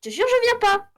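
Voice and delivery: high, loud protest; protestation. Real, not acted. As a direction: refuse loudly, pitched right up. Refuse de suivre son coéquipier. (protestation aiguë)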